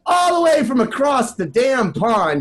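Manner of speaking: shouted, high announcer hype; hype intro. Acted. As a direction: whip up the crowd with a big intro. Hyped host introducing a guest.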